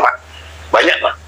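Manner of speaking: usual speaking voice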